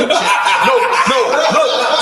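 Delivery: half-laughing imitated alarm; laughing mock panic. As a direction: play the scare while cracking up. Retelling panicked reaction, laughing.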